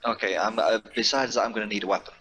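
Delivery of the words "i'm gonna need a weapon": ordinary talk, nothing marked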